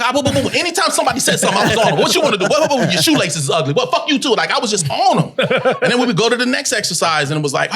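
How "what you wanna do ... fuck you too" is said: combative. Retold or imitated. Acting out combative improv comebacks.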